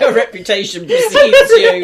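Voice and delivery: high, loud laughing tease. Real, not acted. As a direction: laugh through it.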